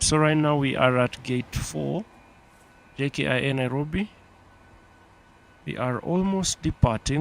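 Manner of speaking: usual speaking voice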